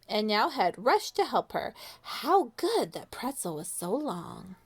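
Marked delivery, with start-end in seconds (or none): none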